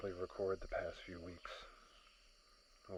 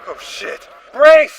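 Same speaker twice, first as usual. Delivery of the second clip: louder and higher, a sudden terrified yell; panicked crash scream; 30 dB louder, slower than usual. Acted. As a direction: scream the warning as the ship goes down. Ship about to crash.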